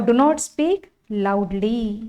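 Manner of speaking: very slow, word by word; slow dictation. Shown as a demonstration. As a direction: read the options out for copying. Teacher dictating answer.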